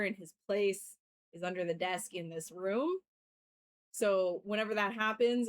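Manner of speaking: ordinary talk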